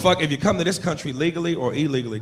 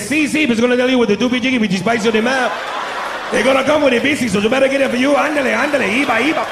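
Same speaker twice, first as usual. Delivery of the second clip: put-on thick Mexican accent; 7 semitones higher, 4 dB louder than usual. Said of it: "He said".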